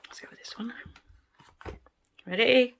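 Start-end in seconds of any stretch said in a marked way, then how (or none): 0.1-0.7 whispered to self. Real, not acted. Choosing next mould, hushed.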